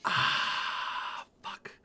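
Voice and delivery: very soft whispered swear; whispered curse. Real, not acted. Player stuck on a spell choice, whispering a curse. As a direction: curse under your breath.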